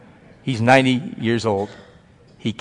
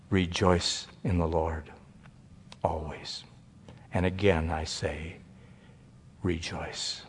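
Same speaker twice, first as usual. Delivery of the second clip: low, much softer reverent reading of scripture; quiet recitation; 7 semitones lower, 13 dB quieter, slower than usual. Acted. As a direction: let the verse fall softly, reverently.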